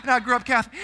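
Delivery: ordinary talk, nothing marked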